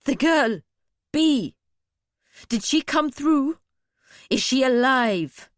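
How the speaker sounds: urgent demand. A narrator's reading. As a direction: demand news of the girl urgently. Urgently demanding after missing girl.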